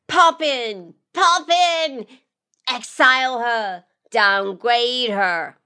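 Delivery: high-pitched calls without much volume; crowd heckling. Acted. Audience members demanding a sentence, restrained high yells. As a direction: call out like several onlookers in a gallery. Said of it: "A few of the audience members yell out".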